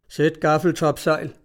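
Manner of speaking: plain reading voice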